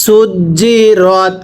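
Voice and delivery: সুজি রত said very slowly with a firm জ; slow drilled reading. Shown as a demonstration. Teacher drills সুজি রত slowly for students. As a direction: drag out সুজি রত, hardening the জিম. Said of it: "কিভাবে পড়তে হবে".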